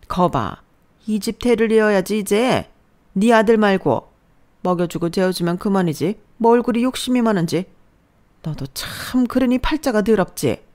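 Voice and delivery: drip contempt, drawing out the first words; scornful mother-in-law. Acted.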